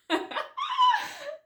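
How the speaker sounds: a high squeal of a laugh. Real, not acted. Laughing while telling friends about the crush. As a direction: burst into a shrill giggle. Laughing at her own story.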